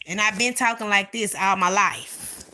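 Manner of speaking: ordinary talk, nothing marked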